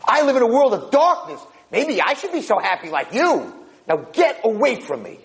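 high-pitched, sarcastic tirade; bitter outburst. Acted. Blind old man rebukes happy-go-lucky roommate, bitter. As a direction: pour out resentment in a high wail.

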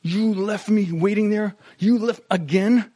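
a spouse acting out hurt turned to anger; angry accusation. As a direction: blame your spouse in a heated burst. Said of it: "translate that hurt into anger".

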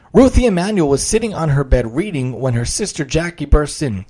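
lively storyteller voice reading aloud; reading a children's story with energy; story narration. A narrator's reading. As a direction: paint the scene for listeners.

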